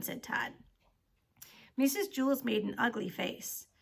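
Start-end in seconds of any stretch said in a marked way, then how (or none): none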